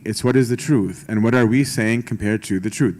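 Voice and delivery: ordinary delivery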